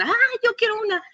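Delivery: put-on high, eager kid begging for a lollipop; childish voice. Teen patient grabbing for the lollipop like a child. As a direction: squeal it like a little kid. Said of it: "les salía el niño".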